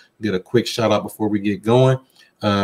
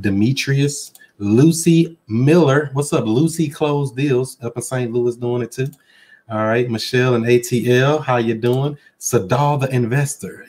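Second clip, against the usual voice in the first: ordinary talk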